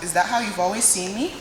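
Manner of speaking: normal talking voice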